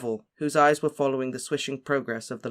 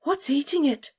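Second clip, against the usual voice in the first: put-on much higher, frightened girl; terrified; 14 semitones higher than usual. A narrator's reading. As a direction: squeak with fear. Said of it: "in a terrified voice".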